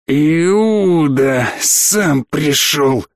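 very slow, menacing drawl; drawn out. A narrator's reading. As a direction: draw every word out like a gloating captor. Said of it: "и Саттор протянул".

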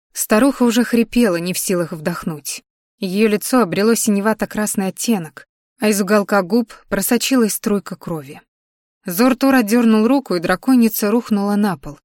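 ordinary delivery, nothing marked

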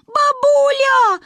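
high, loud slow scream; yelling. A narrator's reading. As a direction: scream for grandma. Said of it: "орала я". (отчаянный крик)

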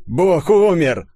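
slow, loud mocking proclamation; mocking soldier. A narrator's reading. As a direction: jeer it out. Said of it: "расхохотался усатый красноармеец". (насмешливо провозглашает красноармеец)

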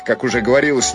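plain reading voice

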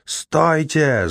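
sharp high yell to halt. Acted. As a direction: bellow the order. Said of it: "заорал Кол".

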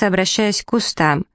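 plain reading voice

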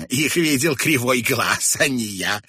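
loud, high irritated retort; cross and baffled. A narrator's reading. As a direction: brush him off crossly. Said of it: "расхохоталась сердито и озадаченно".

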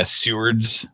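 Sewards said as soo-ards; Sewards pronunciation. Shown as a demonstration. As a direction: stretch Sewards slowly into two syllables. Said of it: "Not swords, but Sewards".

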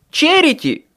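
very high, loud yell; shout. A narrator's reading. Harry shouting to Michael about Charity, loud high yell. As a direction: cry the name out hard. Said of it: "крикнул я".

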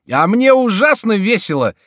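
very high, loud merry exclamation; gleeful. A narrator's reading. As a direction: burst out merrily. Jester reveals himself, mocking the plotters.

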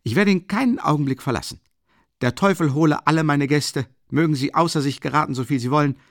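loud, high, quick outburst; calling out. A narrator's reading. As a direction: exclaim it hotly, pushing the pace. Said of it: "rief Rasumichin".

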